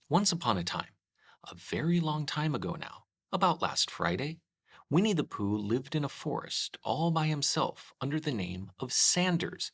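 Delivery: ordinary delivery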